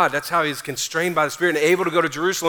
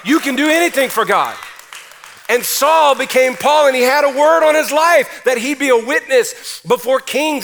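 louder, higher preacher's cry; full-force preaching; 6 semitones higher, 6 dB louder than usual. Acted. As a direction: preach it with everything you have.

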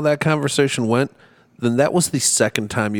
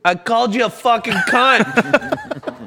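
put-on slow, slurred, higher drunk voice; drunk Justin; 10 semitones higher, slower than usual. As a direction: play the happy drunk owning the insult, slowly. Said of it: "He said".

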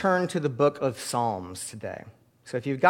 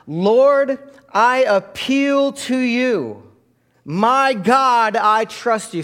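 slow, loud, raised prayer said with the congregation; leading a prayer; 8 semitones higher, 7 dB louder, much slower than usual. Shown as a demonstration. Pastor leading the church in a group prayer, slow and loud. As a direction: pray it out so the church can follow. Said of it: "pray these words with me".

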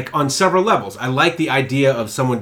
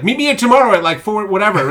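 mock character line; 9 semitones higher, 6 dB louder than usual.

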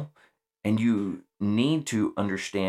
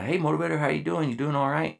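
higher, bright hello; cheerful greeting; 8 semitones higher, faster than usual. Retold or imitated. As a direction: call out warmly to a passing marine. Leader greeting a marine cheerfully.

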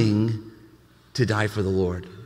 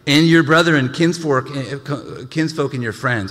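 lifted, louder reading of the verse; scripture reading; 3 semitones higher, 4 dB louder than usual. Acted. Pastor proclaiming scripture to the congregation, raised.

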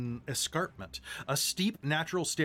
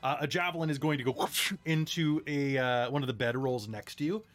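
normal talking voice

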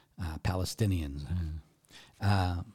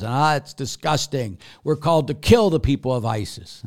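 loud, higher retold outburst of outrage; mock angry yell; 5 semitones higher, 7 dB louder than usual. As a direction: imitate an outraged critic shouting, half-hearted. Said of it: "came to me once and yelled and screamed".

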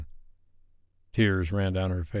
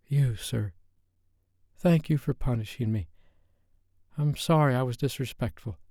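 submissive whisper; 4 semitones higher than usual. A narrator's reading. Submissive reply after punishment.

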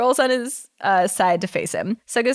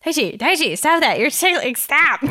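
higher, flustered "stop that"; flustered protest; 7 semitones higher, 4 dB louder than usual. Acted. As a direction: squirm away laughing it off. Suga flustered pushing Daichi away.

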